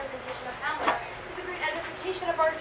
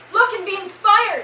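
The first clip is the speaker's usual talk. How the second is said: rousing cry; 5 semitones higher, 10 dB louder, slower than usual. Acted. Rousing the troupe.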